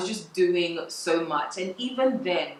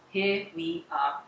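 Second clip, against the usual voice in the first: slow, soft "Here we are"; resigned; 5 dB quieter, slower than usual. Real, not acted. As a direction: let "Here we are" sink slowly. Resigned repeat of phrase.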